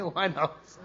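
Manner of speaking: quiet embarrassed laugh on the answer; sheepish laugh. Retold or imitated. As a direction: admit it through a chuckle. Jamaat admits one house, laughing.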